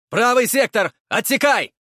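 loud battlefield shout. A narrator's reading. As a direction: yell the command at full voice. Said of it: "прокричал мужчина".

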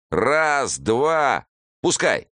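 loud, high count then release; counting heave. A narrator's reading. Thugs swing the prisoner into the pit, loud counting.

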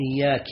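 "إياك" ending in i, iyyaaki; wrong final vowel. Shown as a demonstration. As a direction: say "إياك" with a kasra on the kaf, iyyaaki. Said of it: "هذا لحن".

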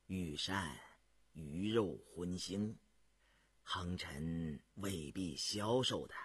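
low, slow, soft counsel; deep-pitched, unhurried elder voice; measured old adviser. A narrator's reading. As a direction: advise slowly and quietly.

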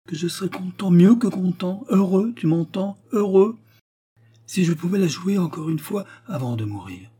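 a dying man's swelling plea; fervent voice rising to a cry; rising passion. Acted. Dying actor pleads passionately.

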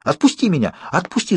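high panicked cry; shouting. Acted. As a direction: cry out to be let go. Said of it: "закричал я".

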